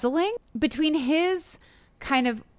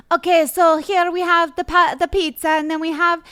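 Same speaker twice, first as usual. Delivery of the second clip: higher, imitated Spanish-accented TV cook; fake Spanish accent; 6 semitones higher, 4 dB louder than usual. Mocking her cooking segment on morning TV, imitated Spanish accent. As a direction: mimic a put-on Spanish accent, groping for the word. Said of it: "where did this accent come from?".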